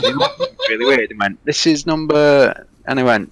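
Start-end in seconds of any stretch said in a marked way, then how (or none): 1.5-2.7 slow, higher mock Polish voice; imitated Polish accent. Polish stranger checking the house number, imitated Polish accent. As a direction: say it slowly with a put-on Polish accent. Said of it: "Very Polish".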